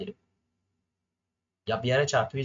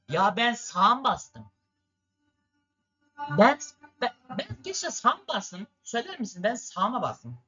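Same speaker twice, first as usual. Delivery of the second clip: indignant disbelief; 9 semitones higher than usual. Real, not acted. Protesting to viewers about the turn.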